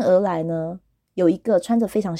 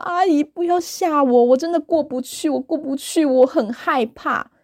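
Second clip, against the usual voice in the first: mock scared pleading; 6 semitones higher than usual. Pleading inner voice to auntie.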